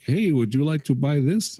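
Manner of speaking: put-on breezy Indian-accented telemarketer pitch; mock Indian call-center accent. Mocking Indian-accented telemarketer cold-calling him, chirpy Indian accent. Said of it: "call centers in India".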